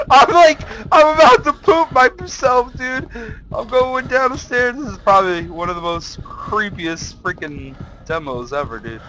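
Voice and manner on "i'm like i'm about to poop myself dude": high voice breaking into laughs; nervous laughter. Real, not acted. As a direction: laugh through the fear, voice pitched up. Laughing off fear on stairs.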